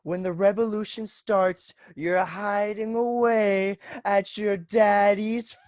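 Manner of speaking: high, slow, taunting sneer at his sister; mocking tease. Teasing his sister for hiding at the farm, high slow mock sneer. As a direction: drag out a mocking jab. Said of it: "I was just like".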